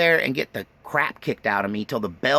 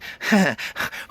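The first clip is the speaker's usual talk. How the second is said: a short, high, sly giggle; Irish Mafia giggle; 6 semitones higher than usual. Retold or imitated. Aunt giggling in the car outside school. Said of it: "my aunt starts giggling this weird kind of".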